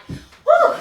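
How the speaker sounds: high, loud laughing woo; playful whoop. Acted. Joking about a bad dolphin dive.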